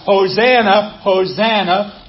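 loud first cry, then slowed and drawn out; a loud cry fading into a slow second call; crowd crying out. Retold or imitated. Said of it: "They cried out".

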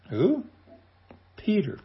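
"Who?" asked high, then "Peter." answered with a drop; rhetorical who. Shown as a demonstration. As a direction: pose the question, then land the answer. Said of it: "rhetorical question and answer".

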